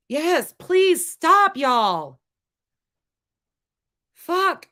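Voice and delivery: slow, high and loud, stretching the stop; fed-up outburst at the chat; heated. Real, not acted. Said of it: "I'm really heated right now".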